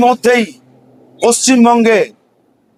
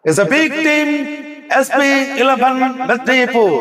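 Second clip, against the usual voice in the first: promo announcer. Acted. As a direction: sell it like a tournament promo. (Promo ঘোষকের ভঙ্গিতে)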